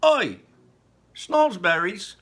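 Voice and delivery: loud, high mocking interruption; cheeky cry. A narrator's reading. Mike TV interrupting Wonka, loud and cheeky. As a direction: blurt out a scoffing question. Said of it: "cried Mike T V".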